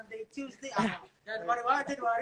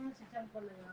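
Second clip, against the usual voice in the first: whispering while hiding; 14 dB quieter than usual. Real, not acted. Hiding during a prank call.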